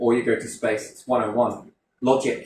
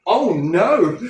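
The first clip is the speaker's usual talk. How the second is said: mock panic; 7 semitones higher, 7 dB louder than usual. Mocking doomsday panic.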